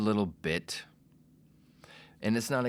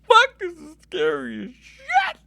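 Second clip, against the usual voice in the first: way higher and louder frightened yelp; panicked yell; 11 dB louder than usual. Retold or imitated. First time before an audience, panicked yelp. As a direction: shriek it like stage fright hit.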